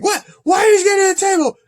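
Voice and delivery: hammed-up shocked question, voice shooting up; shocked Chris Jericho. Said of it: "He's like".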